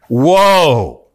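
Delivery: one drawn-out, weighty "woe"; emphatic warning. Shown as a demonstration. Preacher showing what woe means, stop and listen, emphatic warning. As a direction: sound it as a solemn warning.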